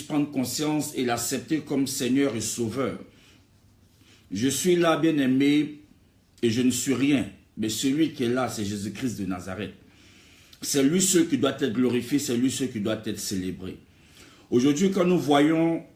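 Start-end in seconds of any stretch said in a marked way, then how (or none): none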